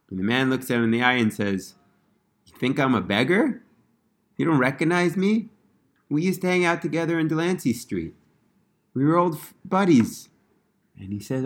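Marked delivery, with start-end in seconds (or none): 2.5-10.3 lightly voiced old friend, higher and teasing; a hint of a knowing old buddy; beggar's retort. The beggar tells the rabbi they were friends, lightly voiced.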